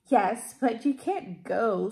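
slow, mean sneering voice; sneering stepsister. A narrator's reading. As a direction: sneer it slowly like a spiteful sister. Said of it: "sneered Griselda".

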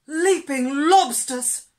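loud, high, drawn-out exclamation; alarmed cry. A narrator's reading. Turtle startled by a monster, loud alarmed cry. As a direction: cry out in comic fright. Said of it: "cried Turtle".

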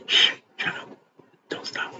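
barely audible whispered shushing; hushing whisper. Acted. Crowd hushing the man calling Jesus, urgent whisper. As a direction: shush them under your breath.

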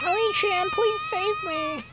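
put-on very high, slow pleading girl voice; high girly plea. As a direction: beg in a tiny high voice. Girl begging for rescue.

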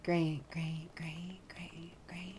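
whispered, deadpan repeated great; whispered sarcastic great. Real, not acted. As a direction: breathe it out, fed up.

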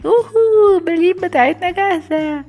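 high, loud excited whoop; excited shout. Pretend. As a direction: cheer that the balloons are ready. Balloons ready for prank. (صيحة حماس عالية)

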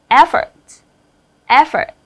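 "effort" as EF-fort, first syllable loud; stress on first syllable. Shown as a demonstration. Teacher drilling word stress for the class, EF-fort punched. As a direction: hit the EF of "effort" hard. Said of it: "cho âm rơi vào thức thứ nhất".

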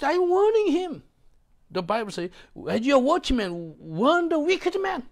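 high, loud fervent preaching; preaching at full force. Acted. Pastor thundering his warning to the congregation. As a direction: preach it out, all fire.

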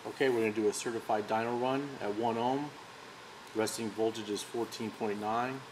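ordinary talk

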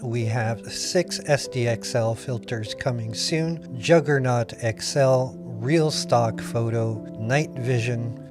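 normal talking voice

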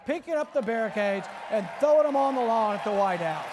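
loud and driving, building to a roar; rally the crowd on this image; a rising, full-voiced rally cry; rousing shout. Real, not acted.

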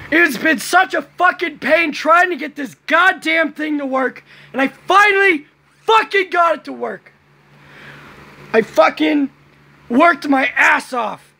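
high-pitched, strained yell of relief; excited screaming. Real, not acted. Vlogger venting about months of pain getting it to work, excited yelling. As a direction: yell it out, overjoyed after a long struggle. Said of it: "because I'm screaming so loudly".

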